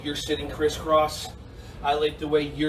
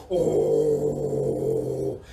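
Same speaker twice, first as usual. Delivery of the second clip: very low, rumbling bear Oh; deep bear sound. Shown as a demonstration. Teacher leading kids in face yoga, low bear sound. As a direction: growl it out like a big bear. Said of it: "make a bear sound".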